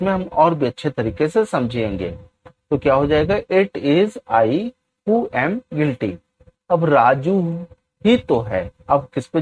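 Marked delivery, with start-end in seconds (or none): 3.3-6.1 each word spaced out slowly for students to copy; very slow, deliberate classroom dictation. Shown as a demonstration. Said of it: "teacher dictates English sentence".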